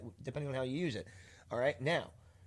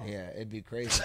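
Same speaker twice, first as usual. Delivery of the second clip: ordinary delivery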